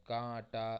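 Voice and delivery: कांटा said kaa-ta, nasal n silent. Shown as a demonstration. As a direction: say कांटा with no n sound. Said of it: "ये नून silent रहेगा". (कांटा में नून silent)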